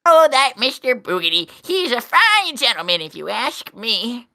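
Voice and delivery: put-on very high old man character voice. Old man recalls his friend Boogity, high old man voice. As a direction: reminisce in a high-pitched old-timer's voice. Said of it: "I have an old man mask".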